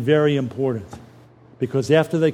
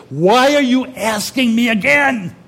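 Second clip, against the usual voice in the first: Peter's pained protest, higher and louder; hurt exasperation; 5 semitones higher, 5 dB louder than usual. Acted. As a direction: cry out, stung at being asked a third time. Petrus gekränkt, dritte Frage.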